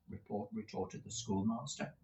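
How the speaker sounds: ordinary delivery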